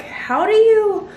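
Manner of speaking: ordinary talk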